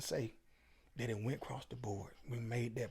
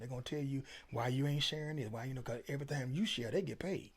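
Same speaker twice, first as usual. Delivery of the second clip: normal talking voice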